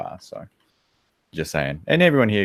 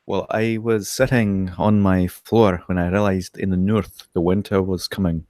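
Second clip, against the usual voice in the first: put-on slow Northern accent slipping into Scottish; Jon Snow; slower than usual. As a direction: attempt Yorkshire, drift toward Scottish. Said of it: "Actually, that's Scottish".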